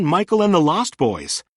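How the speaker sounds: ordinary delivery, nothing marked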